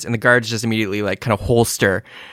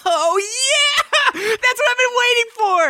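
very high, squealing with glee; high squeal of delight; squeaky excited kid; slower than usual. Acted. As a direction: shriek it like an overjoyed kid. Bradley voiced, squeaky excited.

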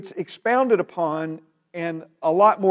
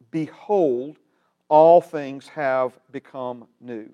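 slow, weighty Bible reading with long pauses; scripture reading; 5 dB louder, slower than usual. Acted. Preacher reads the verse to his class, slow and solemn. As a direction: lay each phrase down like a proclamation.

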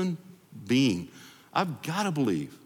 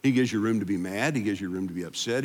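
usual speaking voice

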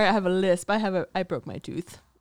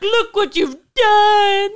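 high, slow mock sob; mock crying; 11 semitones higher, 9 dB louder, much slower than usual. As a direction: wail it like fake crying. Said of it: "I should have been like".

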